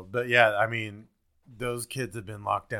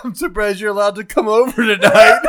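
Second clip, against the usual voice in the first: laughing; 9 semitones higher, 15 dB louder than usual. Real, not acted. Teasing friend while laughing.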